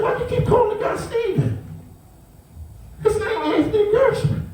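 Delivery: how can you get his name wrong; exasperated. Retold or imitated. Challenging the staff over the nurse's name, high exasperated disbelief.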